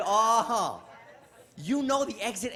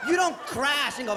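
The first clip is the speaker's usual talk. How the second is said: usual speaking voice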